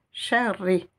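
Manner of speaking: sharri with a long, insistent r; doubled r. Shown as a demonstration. Teacher repeating the shidda for learners, r doubled. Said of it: "comme si j'avais deux lettres ra".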